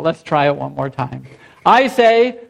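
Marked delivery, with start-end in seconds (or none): none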